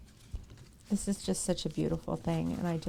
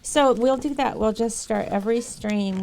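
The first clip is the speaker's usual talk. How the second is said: emphatic; 3 semitones higher, 7 dB louder than usual. Real, not acted. Resolving to read notes daily.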